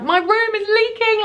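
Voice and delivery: high-pitched, drawn-out mock scream; retold screaming. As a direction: wail it in mock panic without much extra volume. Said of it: "Like, I'm screaming".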